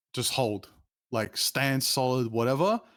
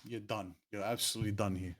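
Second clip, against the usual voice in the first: retold quiet threat; 3 semitones lower, 9 dB quieter than usual. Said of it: "That's when I'm like".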